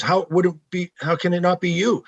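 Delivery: retold incredulous challenge. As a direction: challenge him as the doubting coach. Retold coach challenging his denial.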